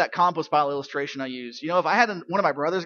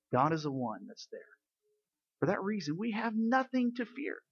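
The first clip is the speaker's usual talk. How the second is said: ordinary talk, nothing marked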